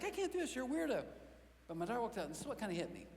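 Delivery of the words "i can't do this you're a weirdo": put-on much higher fed-up kid voice; exasperated son. Son going to bed, exasperated with dad. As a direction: give up on dad. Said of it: "He's like".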